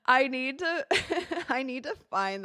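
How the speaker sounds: real laughter breaking up the sentence; laughing. Real, not acted. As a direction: try to talk while cracking up. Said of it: "I'm sorry, I'm laughing".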